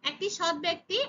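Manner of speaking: normal talking voice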